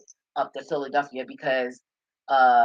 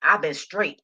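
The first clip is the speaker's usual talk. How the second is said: ordinary talk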